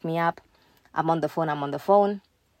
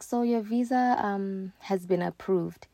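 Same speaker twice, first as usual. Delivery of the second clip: softer, drawn-out mimicked delivery; slow talking; 3 semitones higher, 6 dB quieter, slower than usual. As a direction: imitate a slow, soft-spoken official. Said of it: "she's talking so slow".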